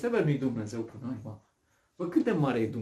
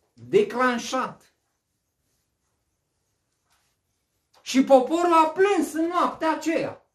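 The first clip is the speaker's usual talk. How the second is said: preaching at full force; 11 semitones higher, 6 dB louder than usual. Acted. Preaching the night of weeping.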